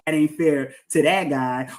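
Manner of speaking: usual speaking voice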